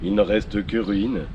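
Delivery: hushed grandiose history voiceover; documentary narration. Acted.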